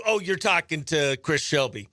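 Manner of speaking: usual speaking voice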